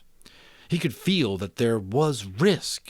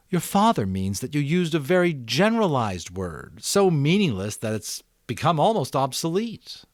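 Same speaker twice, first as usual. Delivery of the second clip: careful explaining; 7 semitones higher, 6 dB louder than usual. A narrator's reading. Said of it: "explained carefully".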